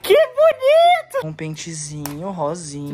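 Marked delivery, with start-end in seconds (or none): 0.0-1.3 mock delight. Mock delight, very high slow.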